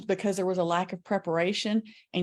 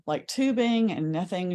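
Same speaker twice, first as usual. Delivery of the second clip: normal talking voice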